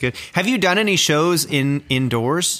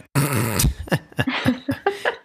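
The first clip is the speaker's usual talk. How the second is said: small restrained laugh. Shown as a demonstration. Host showing a safe way to laugh, small restrained laugh. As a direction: laugh discreetly, keeping it in. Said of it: "guy who laughs like this".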